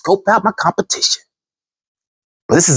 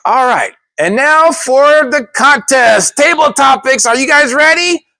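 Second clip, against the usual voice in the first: emcee hype; 9 semitones higher than usual. Retold or imitated. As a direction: pump up the room like a host. Emcee announcing the contest.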